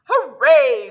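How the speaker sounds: loud cheer of joy. A narrator's reading. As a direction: celebrate out loud. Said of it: "said the mayor".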